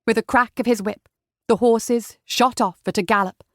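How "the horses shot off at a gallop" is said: plain reading voice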